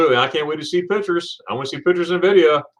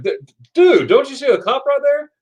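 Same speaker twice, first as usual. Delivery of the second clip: high-pitched, stammering mock alarm; imitated freak-out; 12 semitones higher, 4 dB louder than usual. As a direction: act out a buddy urgently warning the driver. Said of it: "he was freaking out".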